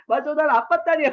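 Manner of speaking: imitated gleeful child. Dad imitating his daughter in the car, gleeful. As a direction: crow it like a delighted daughter. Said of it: "막 웃으면서".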